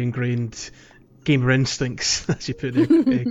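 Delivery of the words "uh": high and louder through a laugh; name her while laughing. Real, not acted. Laughing mid-thanks.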